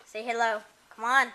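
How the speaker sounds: usual speaking voice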